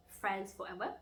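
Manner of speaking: usual speaking voice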